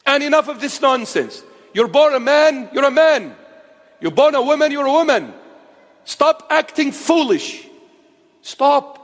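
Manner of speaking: furious street-preacher shout; shouted rebuke. Real, not acted. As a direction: slam it down on the crowd.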